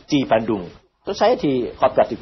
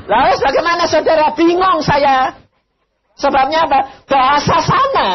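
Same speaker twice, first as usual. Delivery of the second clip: higher and louder preacher's cry; fiery preaching; 12 semitones higher, 7 dB louder than usual. Acted. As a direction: preach it out to the whole hall. Preaching at full force.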